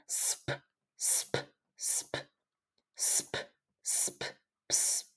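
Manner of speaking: voiceless "sp" breathed out softly; whispered blend. Shown as a demonstration. Teacher whispering the blend for students to copy. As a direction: hiss "sp" with no voice at all. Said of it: "Vamos a hacer esta".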